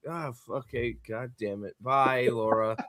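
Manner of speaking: mock-sulky Harry brushing her off; grumbling Harry. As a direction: mutter it, deflated. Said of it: "Harry's like".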